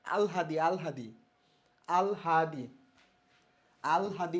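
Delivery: "আলহাদি" repeated as al-ha-di; pronunciation demo. Shown as a demonstration. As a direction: model "আলহাদি" for learners, l before h. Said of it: "উচ্চারণ এটা". ("আলহাদি" বারবার আল-হা-দি করে উচ্চারণ)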